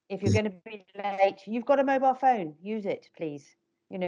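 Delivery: ordinary talk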